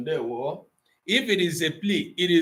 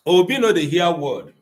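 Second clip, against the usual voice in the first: scolding in Nigerian Pidgin, louder; 5 dB louder than usual. Shown as a demonstration. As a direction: Nigerian Pidgin telling off Obi. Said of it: "don't bring am for pidgin".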